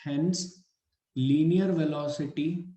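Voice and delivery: slow, measured classroom dictation; word by word, with pauses to write. Acted. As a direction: dictate in short phrases for note-taking. Dictates points for students to write.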